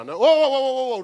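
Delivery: high, loud mock panic; hammed-up alarm; mock alarmed shout. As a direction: yell whoa like you're losing it. Acting out an uncomfortable person.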